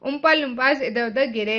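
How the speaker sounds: ordinary delivery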